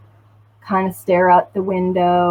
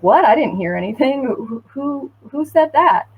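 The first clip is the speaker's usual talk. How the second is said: higher, mock-confused denial; feigned innocent surprise; 6 semitones higher than usual. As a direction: play dumb, rising to a squeak. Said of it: "but being like".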